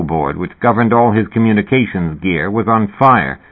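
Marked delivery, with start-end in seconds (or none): none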